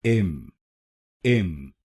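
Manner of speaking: इम alone as a short "im"; isolated syllable. Shown as a demonstration. Teacher drilling the first syllable, sounded out. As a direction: sound out इम by itself. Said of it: "पहले शब्दांश को बोलिए".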